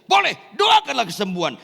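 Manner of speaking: high, loud, eager shout; shouted yes. Retold or imitated. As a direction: shout out wholehearted permission. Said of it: "Langsung saya teriak". (teriakan lantang mengizinkan)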